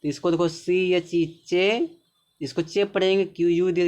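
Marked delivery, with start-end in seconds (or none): none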